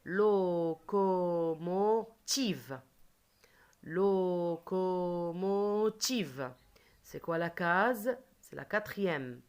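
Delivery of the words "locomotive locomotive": "Locomotive" very slow, every syllable held long; "Locomotive" as four long, even beats; stretched syllables. Shown as a demonstration. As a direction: draw out "Locomotive" so each sound can be heard. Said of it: "Écoutez très bien".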